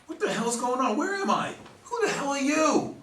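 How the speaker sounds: startled, high, frantic yelling; patient jolts awake strapped down, panicked high shout. Acted. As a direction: wake up terrified and yell at the stranger. Startled awake, restrained in bed.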